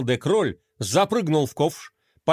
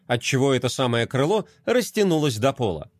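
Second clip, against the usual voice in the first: plain reading voice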